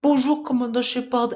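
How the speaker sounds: high-pitched southern French woman; Eve, southern accent. Acted. Krogan woman greeting commander, high southern French accent. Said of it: "c'est un truc comme ça".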